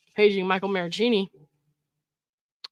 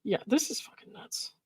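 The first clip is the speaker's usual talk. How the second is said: disbelieving whisper; 8 dB quieter than usual. Real, not acted. Reacting to the texts.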